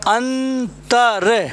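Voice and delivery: अंतर stretched very slowly, each sound clear; slow demo. Shown as a demonstration. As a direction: draw out अंतर for the class. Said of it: "बोल कैसे रहे हैं, देखना". (धीरे धीरे बोलकर दिखाना अंतर)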